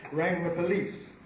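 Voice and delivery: normal talking voice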